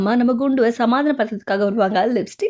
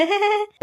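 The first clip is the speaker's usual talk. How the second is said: granny chuckle; 11 semitones higher, 3 dB quieter than usual. Acted. Grandmother laughs it off.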